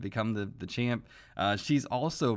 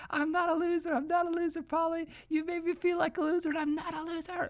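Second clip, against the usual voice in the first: screaming Adrian. Retold or imitated. Adrian screaming at her abusive brother Paulie, high mock shriek. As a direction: shriek it at him like Adrian finally snapping. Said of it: "And she's like".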